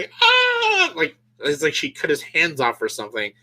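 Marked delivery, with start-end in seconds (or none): 0.2-0.9 mock scream. Jamie Lee Curtis looking at them, high mock scream. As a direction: imitate a shrieking woman. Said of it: "starts screaming".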